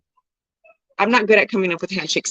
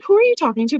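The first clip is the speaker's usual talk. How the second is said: higher, offended who are you talking to; retold offended question, pitched up; mock indignant question; 8 semitones higher, much faster than usual. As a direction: toss it back, playfully affronted. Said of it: "I was like".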